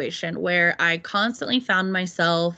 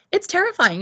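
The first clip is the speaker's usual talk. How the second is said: jumps up high and louder; high exclaimed fear; 11 semitones higher, 5 dB louder, faster than usual. Real, not acted. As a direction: blurt it out, wide-eyed. Describing son's medical scares.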